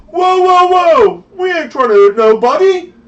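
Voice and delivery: high, loud, frantic shouting; panicked thug leader. Acted. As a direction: yell to stop the fight in a panic. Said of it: "the leader guy".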